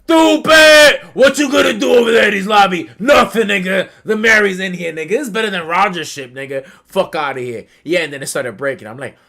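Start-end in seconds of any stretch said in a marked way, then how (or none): 0.0-7.6 loud, high jeering shout; loud high mocking yell; mock shouting taunt. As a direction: shout it down like a hype man. Mocking someone at the air horn.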